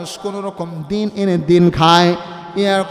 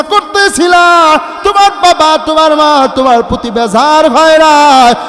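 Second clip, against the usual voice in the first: preaching at full force; 8 semitones higher, 8 dB louder than usual. Acted. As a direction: hold the repeat long and loud.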